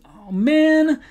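exaggerated casual groan; dramatic dismay. Shown as a demonstration. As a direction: whine it like a teenager. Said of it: "spice it up a little bit by throwing some extra words".

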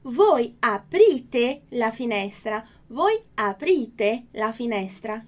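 slow, clear Italian model reading; careful teacher pronunciation; slow model sentence. Shown as a demonstration. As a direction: read it slowly for students to repeat. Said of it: "Próximo exemplo".

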